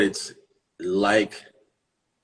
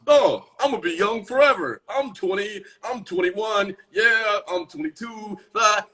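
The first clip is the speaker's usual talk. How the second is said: a hint of a naive young voice, higher; carefree twenty-something; 9 semitones higher than usual. Mocking young people who think youth lasts, lightly naive. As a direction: lightly play a carefree kid. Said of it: "Here's the mentality of the twenties".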